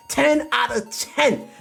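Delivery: high, slow, stretched-out verdict; emphatic hype. Real, not acted. Streamer giving chat the final rating, emphatic hype. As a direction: spell out the verdict slowly and high.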